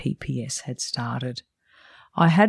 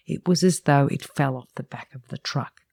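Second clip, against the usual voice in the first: normal talking voice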